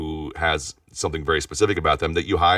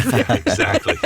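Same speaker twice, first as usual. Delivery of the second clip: laughing; 13 semitones higher, 5 dB louder than usual. Real, not acted. Laughing at the demos joke.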